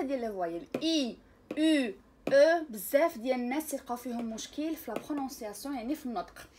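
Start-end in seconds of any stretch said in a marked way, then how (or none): 0.8-2.6 loud teacher drill of each vowel. Shown as a demonstration. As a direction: call out each vowel for the class. Drills French vowels aloud.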